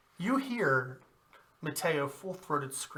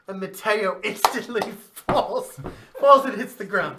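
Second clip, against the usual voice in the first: laughing; 7 semitones higher, 12 dB louder than usual. Real, not acted. GM laughing at the fall.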